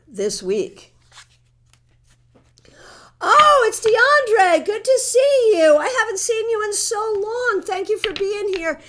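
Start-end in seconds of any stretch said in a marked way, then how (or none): 3.2-8.8 bright, high, delighted hello; excited greeting. Real, not acted. Host thrilled to greet a viewer she hasn't seen in ages.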